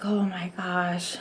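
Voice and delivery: soft, slow whisper; whispered surprise. Retold or imitated. Mom giving in to her daughter, whispered. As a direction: breathe it out, half amazed.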